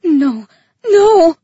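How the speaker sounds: terrified wail of refusal; panicked cry. A narrator's reading. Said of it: "I cried".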